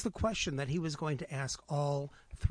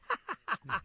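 hyena giggle; 8 semitones higher than usual. Acted. Hyena laughs again.

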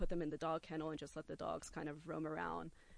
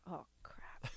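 breathy whispered oh crap; whispered panic; whispered dread; 8 dB quieter than usual. Retold or imitated. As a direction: whisper it in dismay. Dread at the birds.

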